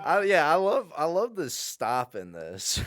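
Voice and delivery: loud, high, drawn-out fake enthusiasm; over-the-top excited shout; mock gushing. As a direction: gush at full volume, slowly. Exaggerated mock enthusiasm over movie.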